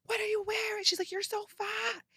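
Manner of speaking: high, soft imitation of a scolding aunt; mock screaming aunt. Aunt scolding her niece at the party, a high mock voice. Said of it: "She's screaming at me".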